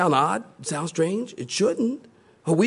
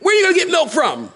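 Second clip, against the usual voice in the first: put-on arguing baby; 12 semitones higher, faster than usual. Imagined newborn argues with mom, high whiny protest. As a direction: whine like a demanding baby. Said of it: "Not like".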